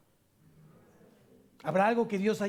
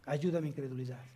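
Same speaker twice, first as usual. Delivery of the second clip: softer, lower, humble plea; quiet prayer; 6 semitones lower, 6 dB quieter than usual. Pretend. Believer praying to the Lord for faith, softly. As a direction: murmur it as a humble prayer to God.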